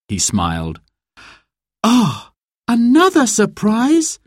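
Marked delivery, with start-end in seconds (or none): 1.8-4.2 high, breathless rising question; surprised gasp. A narrator's reading. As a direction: gasp at the unexpected news. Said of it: "gasped Sarah".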